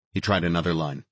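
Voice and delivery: ordinary delivery, nothing marked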